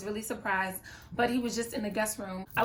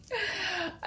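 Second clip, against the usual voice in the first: high laugh; 9 semitones higher, 4 dB quieter than usual. Real, not acted. Laughs at her own doubt.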